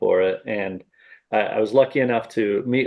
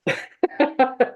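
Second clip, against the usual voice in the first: burst of laughter. Real, not acted. Big laugh at own joke.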